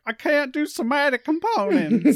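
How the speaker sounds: high, strained whiny voice; strained wizard voice. Retold or imitated. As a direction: squeak it out while straining. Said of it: "trying to lift their arm in full plate mail and is like".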